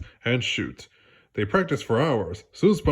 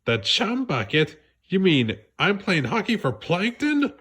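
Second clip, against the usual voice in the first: surprised; 9 semitones higher than usual. Acted. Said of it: "SpongeBob's eyes widened".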